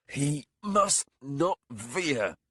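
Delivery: very slow, high, loud insistence; intense warning. A narrator's reading. Warlock warning the captain over the body, slow high insistence. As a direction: press each word, grave and urgent.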